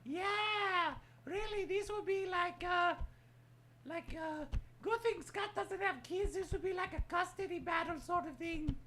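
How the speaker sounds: squeaky high character voice; high cartoon voice; high puppet voice. Puppet voice commentary.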